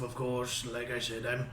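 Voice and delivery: ordinary talk, nothing marked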